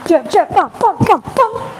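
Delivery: high-pitched staccato punch sounds; punch-by-punch sound effects; voiced punch combo. Shown as a demonstration. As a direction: call each punch as it lands, high. Calling out punches in a demo.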